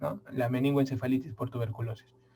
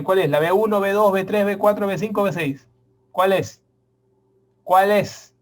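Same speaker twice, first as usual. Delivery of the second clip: flustered guessing; 4 semitones higher, 6 dB louder than usual. Retold or imitated. Mock student listing vitamins frantically.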